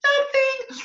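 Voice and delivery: very high, loud "Nothing"; chipmunk echo. Acted. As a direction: squeak it back teasingly. Chipmunk repeats.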